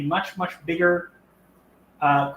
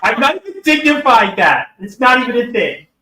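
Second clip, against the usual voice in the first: laughing shout; 6 semitones higher, 8 dB louder than usual. Real, not acted. Laughing outburst at a comment.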